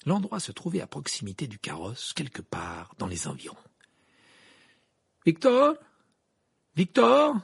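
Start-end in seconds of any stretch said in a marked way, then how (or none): 5.2-7.4 high, loud shout for someone missing; calling out. A narrator's reading. Harry calls for a missing man in the forest, calling out. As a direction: shout the name into the dark. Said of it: "cria Harry".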